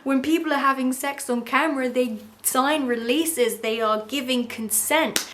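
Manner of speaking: loud, high, firm mock anger; furious lecture. Erica scolding Rand about consent, mock furious. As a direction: hammer home each point. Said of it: "She's like".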